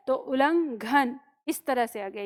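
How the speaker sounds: usual speaking voice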